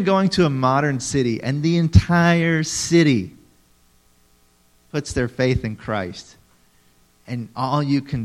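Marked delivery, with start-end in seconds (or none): none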